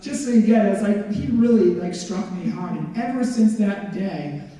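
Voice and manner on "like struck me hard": usual speaking voice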